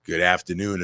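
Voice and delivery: usual speaking voice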